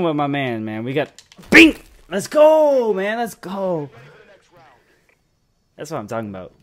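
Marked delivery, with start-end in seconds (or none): 1.5-1.8 high, loud, short "bing!"; imitated ping. Real, not acted. Player sounding out his hit mid-sentence, excited bing. 2.1-6.5 triumphant cheer. Real, not acted. Player cheering with his teammate's push, triumphant.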